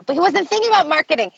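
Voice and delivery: high-pitched, rapid-fire insistence; real excitement. Real, not acted. Podcast guest arguing about what Jobs was thinking, excited. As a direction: get carried away making your point, pitch climbing. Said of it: "sorry, I'm excited".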